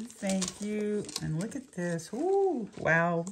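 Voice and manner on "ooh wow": high delighted ooh then a louder wow; thrilled gasp at a pretty present; excited ooh. Real, not acted. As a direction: gush over the gift. Pulling out a pretty item.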